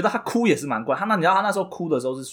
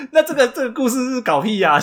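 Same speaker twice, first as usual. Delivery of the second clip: laughing exasperation; 10 semitones higher, 4 dB louder than usual. Real, not acted. S0 laughing at absurd story.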